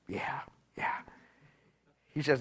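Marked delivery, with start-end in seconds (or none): 0.1-1.0 whispered, embarrassed yeah yeah; ashamed whispered confession; sheepish whisper. Retold or imitated. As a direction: breathe it out like a caught man admitting it. Sheepish admission to the pastor.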